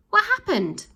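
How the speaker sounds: high, loud call from above. A narrator's reading. Ned calls down to the sad children, high and loud. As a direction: shout it up to the crowd below. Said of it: "Ned called out from above".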